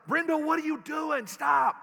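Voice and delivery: sudden alarmed yell, high and loud; startled awake, yelling at his wife to stop. Retold or imitated. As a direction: shout at her to stop the shaking. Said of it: "yell out is".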